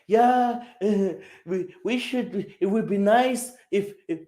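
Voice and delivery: high, hedging mock politician voice; waffling politician. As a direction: imitate a politician who won't commit to anything. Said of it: "This is a politician".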